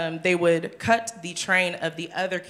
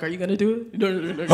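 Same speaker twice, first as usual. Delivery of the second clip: nervous seraphs; 4 dB louder, much faster than usual. Pretend. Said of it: "just looking at each other like".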